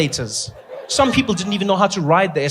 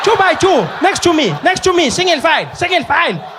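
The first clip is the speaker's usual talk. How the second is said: much higher, louder frantic calling; panicking teacher; 10 semitones higher, 5 dB louder than usual. Retold or imitated. Teacher losing control of the class, shouting in panic. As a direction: shout over a crowd of wild kids. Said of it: "She was like".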